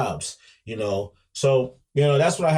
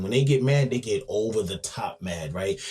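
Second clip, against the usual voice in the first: ordinary delivery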